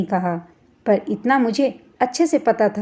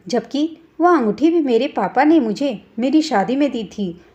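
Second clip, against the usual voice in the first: usual speaking voice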